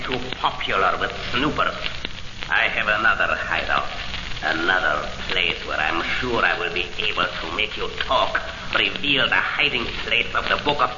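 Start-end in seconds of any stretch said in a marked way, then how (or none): 2.5-8.4 low, controlled menace; measured villainous threat. Acted. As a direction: promise trouble in a deep, unhurried voice.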